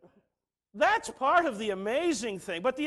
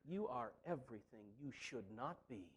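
hushed divine rebuke; 10 semitones lower, 16 dB quieter than usual. Acted. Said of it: "God in love looks at us and says".

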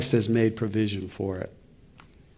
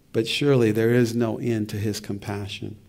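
ordinary delivery

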